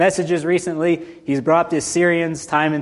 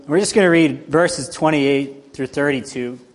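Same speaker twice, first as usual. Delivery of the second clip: ordinary delivery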